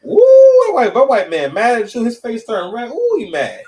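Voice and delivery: high, loud, hooting tease; playful high-pitched 'ooh, he mad' jeering; mock onlooker's teasing. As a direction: crow at how mad he is.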